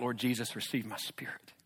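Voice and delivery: breathy dying whisper of a martyr; whispered final prayer; hushed whisper. A narrator's reading. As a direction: let the last prayer fade to a whisper. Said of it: "He cries out".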